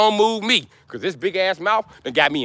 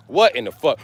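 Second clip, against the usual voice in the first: ordinary talk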